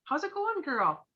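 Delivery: high, bright, sing-song greeting; light surface greeting to a friend; breezy hello. Shown as a demonstration. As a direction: toss off a quick chipper hello. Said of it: "you just like".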